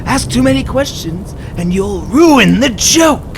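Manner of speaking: normal talking voice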